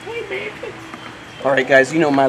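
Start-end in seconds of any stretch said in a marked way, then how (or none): 0.1-0.5 high, soft coo; a tender high greeting. Real, not acted. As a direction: coo at your pet lizard. Cooing greeting to pet.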